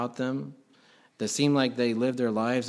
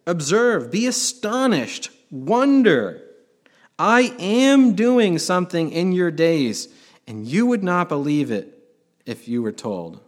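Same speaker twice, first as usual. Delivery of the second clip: louder and higher, slow proclaiming; commanding God voice; 4 semitones higher, 6 dB louder than usual. Acted. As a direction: announce each command like God himself. Preacher voices God's answer.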